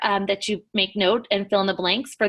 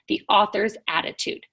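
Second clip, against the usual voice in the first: ordinary delivery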